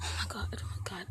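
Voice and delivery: frightened whispered oh my God; terrified gibbering. Pretend. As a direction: breathe it out in terror. Hiding, scared whisper.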